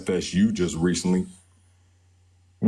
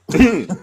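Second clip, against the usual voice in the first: higher, loud burst of laughter; real loud laugh; 8 semitones higher, 6 dB louder than usual. Real, not acted. Host laughs at caller's jibe about the dog, loud. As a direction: laugh out loud at being teased.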